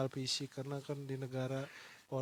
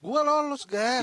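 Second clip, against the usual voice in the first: excited; 7 semitones higher, 10 dB louder, slower than usual. Real, not acted. Proud answer about passing.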